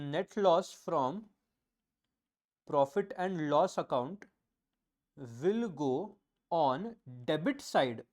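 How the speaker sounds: very slow, one word at a time; slow dictation. Shown as a demonstration. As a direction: spell it out for their notebooks. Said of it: "Second, if there is net loss". (धीरे-धीरे लिखवाना)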